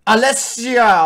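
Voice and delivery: loud, slow proclaimed name; mirror shouts. Retold or imitated. Mirror answers the princess, loud and slow. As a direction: boom out the name. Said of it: "die spiegel zo en dan zegt".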